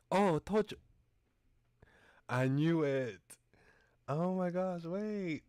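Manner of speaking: loud, high-pitched exclamations; excited vindication. Real, not acted. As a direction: crow it, thrilled to be right. Guess about the game confirmed.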